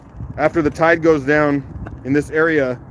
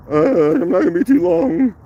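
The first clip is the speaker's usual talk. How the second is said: higher, put-on morning voice; 8 semitones higher than usual. Shown as a demonstration. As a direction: pitch it up as a joking morning voice. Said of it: "that's my morning voice".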